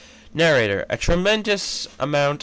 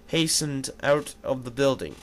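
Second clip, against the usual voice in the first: ordinary delivery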